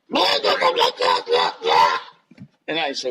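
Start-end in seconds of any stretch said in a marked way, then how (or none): 0.1-2.0 goblin gibberish. Retold or imitated. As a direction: babble like a little goblin. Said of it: "he goes".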